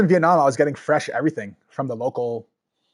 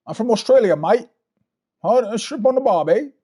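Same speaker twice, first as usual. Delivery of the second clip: hammed-up Australian accent, higher and louder; mock Australian accent; 9 semitones higher, 6 dB louder than usual. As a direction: put on a jokey Australian accent, mate. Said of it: "you have an accent".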